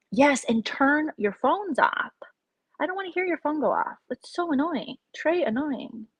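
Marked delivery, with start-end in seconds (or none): 0.1-4.0 mock bossy order. To viewers, prim mock order. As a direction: play the strict usher.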